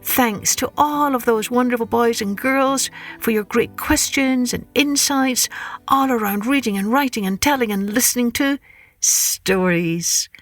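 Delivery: ordinary delivery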